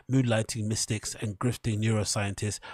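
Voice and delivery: ordinary delivery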